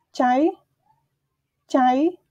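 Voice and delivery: "chai" said slowly as kai, rising; careful teacher demo; Khmer word chai. Shown as a demonstration. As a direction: model the word chai for learners. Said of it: "teacher models Khmer word".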